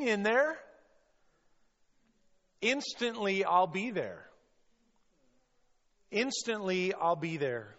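high, loud rallying reassurance; emphatic encouragement. Acted. Preacher as Jesus rallying a doubting Peter, emphatic encouragement. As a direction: urge them on with fervor, phrase by phrase. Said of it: "He's saying".

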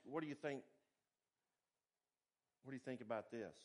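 a mentor inviting your view; kindly asking. Retold or imitated. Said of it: "and said kindly".

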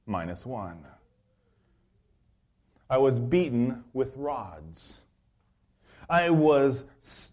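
slow, solemn reading of Paul's hardships; scripture reading. Acted. Preacher to congregation reading Paul's sufferings, slow and solemn. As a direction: read each line deliberately, letting it land. Reads Paul's list slowly.

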